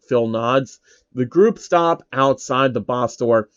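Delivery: ordinary delivery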